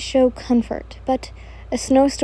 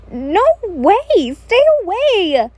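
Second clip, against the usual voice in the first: very high, loud, stretched-out wail; flustered refusal; 8 semitones higher, 5 dB louder, slower than usual. Acted. You fending off Kojo's proposal, high flustered refusal. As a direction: wail the protest, drawing out the vowels.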